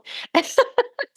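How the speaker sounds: a high-pitched giggle that runs up. Real, not acted. Host giggling high at her silly bird joke. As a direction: giggle up high at your own silliness.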